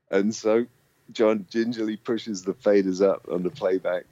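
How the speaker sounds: ordinary delivery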